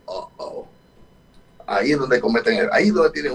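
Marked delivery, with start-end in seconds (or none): 0.1-0.7 soft breathy uh-oh, caught out; a hushed oops as it sinks in; uh-oh realization. Retold or imitated. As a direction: whisper the dawning mistake. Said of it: "y dices".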